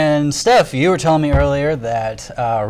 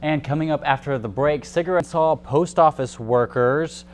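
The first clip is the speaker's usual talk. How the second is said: news read; 5 dB quieter than usual. Acted. Anchor reads news teaser.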